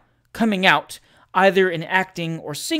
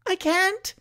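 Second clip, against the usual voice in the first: very high little voice; high, helpless singer's reply; helpless protest; 13 semitones higher than usual. A narrator's reading. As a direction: plead it in a high voice. Failing singer protests to prompters.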